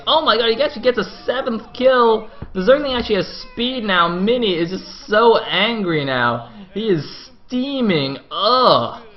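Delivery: high, loud, words stretched for emphasis; amazed hype. Real, not acted. Caster on the seventh kill, loudly amazed. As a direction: exclaim it, leaning hard on key words.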